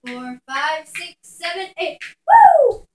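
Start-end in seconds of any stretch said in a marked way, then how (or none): 2.3-2.9 loud high woo; high cheering whoop. Real, not acted. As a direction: whoop like a fan.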